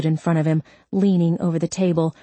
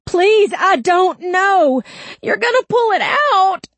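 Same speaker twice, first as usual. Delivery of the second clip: desperate shouting; 6 dB louder than usual. Acted. Screaming while held by nose ring.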